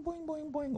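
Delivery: high bouncy boing sounds; cartoon bounce noises, squeaky high. Bouncing check sound effect.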